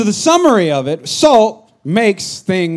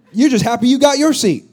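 ordinary talk, nothing marked

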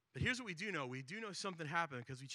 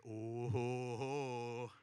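low mock-gloating chuckle, then slow and louder; gloating crowd; 3 semitones lower, 6 dB louder, much slower than usual. Crowd member gloating over Zacchaeus, low then slow and loud. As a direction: savor someone else's coming trouble. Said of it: "you're thinking".

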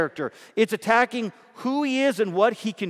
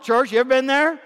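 usual speaking voice

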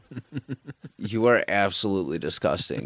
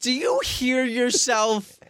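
high mock outrage; 3 dB louder than usual. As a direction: squeak it out in fake shock.

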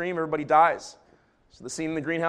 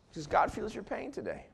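gentle comfort; 2 semitones higher, 5 dB quieter than usual. Real, not acted. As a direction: speak tenderly to those in pain. Comforting those in loveless marriages.

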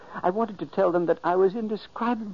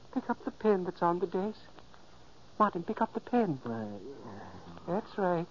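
quiet, gentle step-by-step guidance; soft coaxing; 8 dB quieter than usual. Acted. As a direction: ghost quietly guiding sleepwalking brother to the desk. Ghost coaxing sleepwalker gently.

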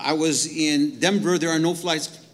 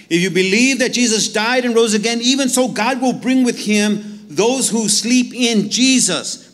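louder, higher fervent reading; preaching at full force; 5 semitones higher, 7 dB louder than usual. Acted. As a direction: cry it out to the congregation. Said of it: "raise your hand if you believe that".

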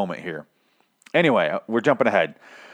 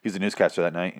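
ordinary talk

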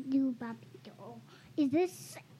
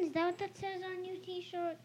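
normal talking voice

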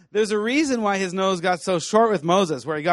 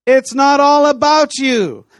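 loud, slow and high; emphatic shout; 5 semitones higher, 10 dB louder, slower than usual. Real, not acted. Preacher to D T S friends, loud emphatic slow. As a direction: shout it at them.

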